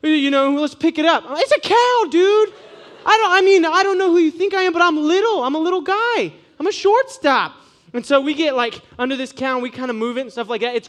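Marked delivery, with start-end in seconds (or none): 0.0-1.2 high, loud urgent suggestion; mock-frantic plea to lift it; panicked friend. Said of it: "He's like". 1.3-7.5 very high, loud squeaky protest; frantic protest. Retold or imitated. His own retort in panic.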